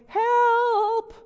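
high, loud mock call for help; mock cry for help. Mother in the drawing calling for help, mock cry. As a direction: cry out as if drowning. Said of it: "I'm, I'm going".

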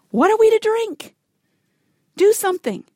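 high, loud demand; grumbling complaint. Acted. The thirsty people grumbling against Moses in the desert, grumbling. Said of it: "the people grumbled against Moses".

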